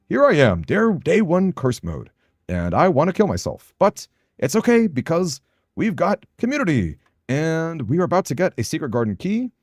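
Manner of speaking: high, animated YouTuber voice; punchy hype for retention; YouTube shorts voice. Shown as a demonstration. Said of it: "when I'm talking like this".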